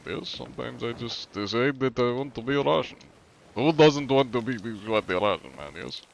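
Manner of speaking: put-on Russian accent. Streamer joking to chat about wanting to be Russian, mock Russian accent. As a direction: play a Russian, ending on a checking "yes". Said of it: "They just do the Russian accent".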